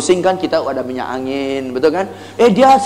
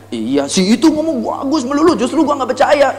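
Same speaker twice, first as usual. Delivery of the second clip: customer's indignant agreement; 8 semitones higher than usual. Acted. Acted customer complaining about rival.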